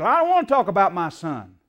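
loud, hostile bark about his son; angry shout. Retold or imitated. Gunman at his door yelling at the preacher, loud and angry. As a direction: shout him off the subject.

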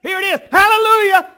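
high, loud whoops of victory; jubilant shout. Acted. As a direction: shout for joy like a crowd that just got its weapon. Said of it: "All Israel did what?".

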